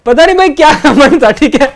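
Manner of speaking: laughing at past self. Real, not acted. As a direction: laugh it off, amazed at yourself.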